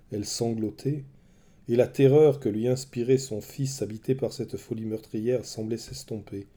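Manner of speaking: ordinary delivery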